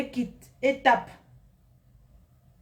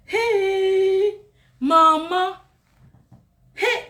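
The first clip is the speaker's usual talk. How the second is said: excited call; 9 semitones higher, 6 dB louder, much slower than usual. Real, not acted. Excited calling out to mom.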